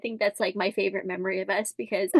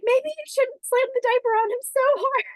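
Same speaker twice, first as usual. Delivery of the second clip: high, loud pleading objection; mock alarmed protest; 5 dB louder than usual. Wife to husband slamming on the diaper, mock alarmed protest. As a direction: protest in hammed-up disbelief.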